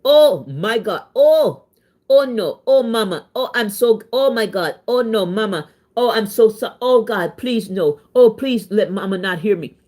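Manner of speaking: mock terror, begging his mama; imitating his drunk panic on the phone; freaked out. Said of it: "He freaked out".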